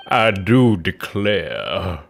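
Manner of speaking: "I do declare" very slow and low, in a thick Southern drawl; deep, slow Southern "I do declare"; heavy southern drawl. Acted. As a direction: stretch out "I do declare" like an old Southern gent. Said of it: "she has, like, a southern accent now".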